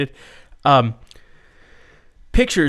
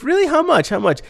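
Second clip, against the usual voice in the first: much higher, quick mock haggling; eager masseuse; 13 semitones higher than usual. Masseuse asking Steve the price, high mock eagerness. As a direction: imitate her jumping at the deal.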